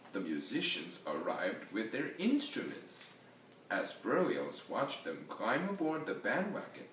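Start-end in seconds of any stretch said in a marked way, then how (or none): none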